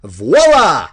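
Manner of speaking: loud, bright reveal; excited exclaim. Shown as a demonstration. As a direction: announce it like the cake just appeared. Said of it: "We're gonna put an exclamation mark".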